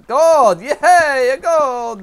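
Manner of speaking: high, loud whooping cheer; mock celebration. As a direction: cheer wildly as if it mattered. Mock celebration of finding gold.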